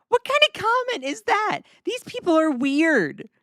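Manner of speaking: reading a rude chat comment, squeaking in disbelief; incredulous disbelief. Real, not acted. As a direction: sound baffled and offended at the comment.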